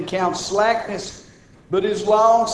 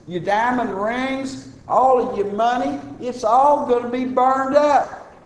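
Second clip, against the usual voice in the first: louder, higher fervent pulpit warning; preaching at full force; 5 semitones higher, 3 dB louder than usual. Acted. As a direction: thunder the warning to the congregation. Preaching on the last day.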